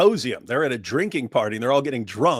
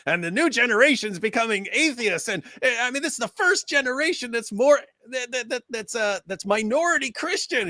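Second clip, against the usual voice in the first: high mock pundit voice; mocking Bill Maher; 10 semitones higher than usual. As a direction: parody a pompous host, pitched up. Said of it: "he's talking about".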